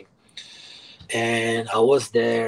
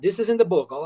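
normal talking voice

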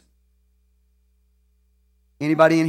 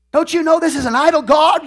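zealous outburst; 11 semitones higher, 5 dB louder than usual. Pretend. Mock zealot chainsawing mama's tree.

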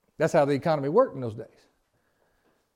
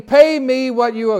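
higher, louder demand for payment; demanding servant; 6 semitones higher, 7 dB louder than usual. Acted. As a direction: grab him by the throat and demand it. Servant grabs debtor by throat.

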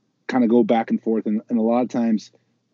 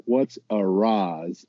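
bad gringo Spanish; 3 semitones lower, 4 dB quieter, much slower than usual. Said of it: "we're always like".

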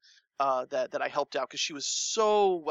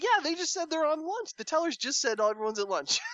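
indignant; 12 semitones higher than usual. Retold or imitated. Telling customers tellers at lunch.

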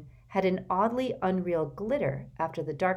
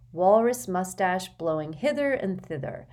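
ordinary delivery, nothing marked

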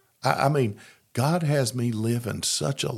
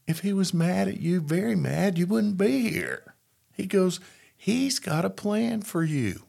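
higher, lightly voiced reassuring sponsor; a hint of the kindly sponsor, pitched up; gently convincing a doubting sponsee, lightly voiced; 10 semitones higher than usual. Sponsor's reassuring words, retold.

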